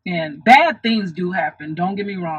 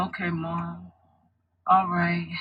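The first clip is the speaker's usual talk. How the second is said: sleepy; 6 dB quieter than usual. Retold or imitated. Sleepy daughter answering her mom's hug, drowsily. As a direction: imitate mumbled assent when half awake. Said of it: "I was so sleepy".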